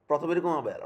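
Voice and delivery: ordinary delivery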